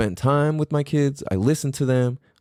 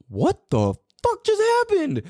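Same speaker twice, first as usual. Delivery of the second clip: much higher, stunned outburst; retold disbelief. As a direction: replay the shock of getting smoked. Retelling being beaten at running.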